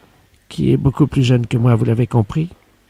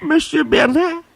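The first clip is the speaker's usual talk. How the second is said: high, slow, dreamy gasp of the name; can't believe it's him; swooning disbelief; 13 semitones higher, slower than usual. Acted. Secretary swoons at her boss's name, high and slow.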